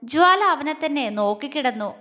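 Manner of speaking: plain reading voice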